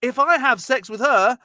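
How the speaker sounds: hammed-up dismayed shout; whiny protest. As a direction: refuse like a sulky teen. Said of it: "Onan's like".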